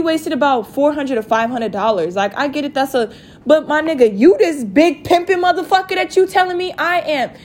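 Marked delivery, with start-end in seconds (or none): 3.5-7.3 high, worked-up indignant protest; voice getting high. Real, not acted. Woman rebutting his big pimpin' insult, worked-up high voice. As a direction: let the pitch climb as you get heated. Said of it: "I'm getting my voice high for this shit".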